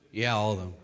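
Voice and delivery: low, soft, deadpan answer; matter-of-fact reply. Acted. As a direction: drop low and quiet, unfazed. Voicing Jesus's calm answer.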